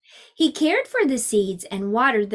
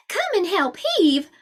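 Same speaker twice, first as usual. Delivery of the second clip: wife's call; 6 semitones higher than usual. Acted. Wife calls the boy.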